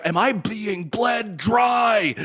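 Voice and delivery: drawn-out shout of financial panic; desperate shout. Retold or imitated. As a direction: cry it out like you're losing it. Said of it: "where it's like".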